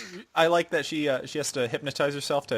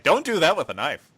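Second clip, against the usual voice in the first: firm raised warning voice; stern raised warning; emphatic warning; 5 semitones higher, 5 dB louder than usual. Retold or imitated. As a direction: spell it out in all caps. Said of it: "in all caps".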